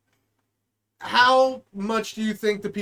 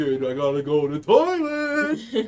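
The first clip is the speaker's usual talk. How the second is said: lazy thief voice; 7 semitones lower, slower than usual. Retold or imitated. Thief voiced selling toilet.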